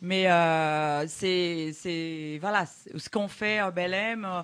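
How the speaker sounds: Brazilian accent in French; foreign accent in french. Real, not acted. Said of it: "excuse-moi mon français".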